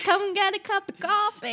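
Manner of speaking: American accent copied from a TV show, hammed up; put-on American accent. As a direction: gush it in a bubbly American accent. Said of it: "I've just been watching Ginny and Georgia".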